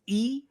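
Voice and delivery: "e" said as a long ee; Japanese I. Shown as a demonstration. Teacher showing listeners how I sounds, ee vowel. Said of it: "I is pronounced e".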